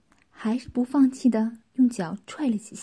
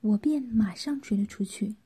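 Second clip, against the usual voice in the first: ordinary delivery, nothing marked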